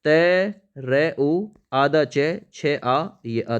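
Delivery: letters drawn out; slow spelling out. Shown as a demonstration. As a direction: spell tarucch slowly. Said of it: "तरुच्छ छाया इस type से". (धीरे-धीरे अक्षर बोलते हुए)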